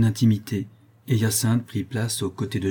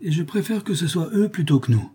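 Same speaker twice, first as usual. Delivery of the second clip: higher, sniggering coachman's voice; sneering snigger; 8 semitones higher than usual. A narrator's reading. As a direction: snigger it out with grim relief. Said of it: "ajouta-t-il en ricanant". (ricanement plus aigu)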